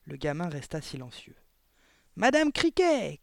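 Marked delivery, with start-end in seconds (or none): 2.2-3.2 loud, high, drawn-out call; stretched-out summoning yell; shouted call. A narrator's reading. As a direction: call the cook from across the hall. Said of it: "cria mademoiselle Le Gourdin".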